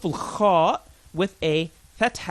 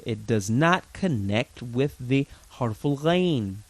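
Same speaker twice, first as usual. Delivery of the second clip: ordinary delivery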